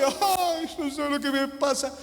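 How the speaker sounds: high, mock whimpering complaint; mock a self-pitying crier; whiny crier. Preacher mocking a tearful friend, whiny high voice.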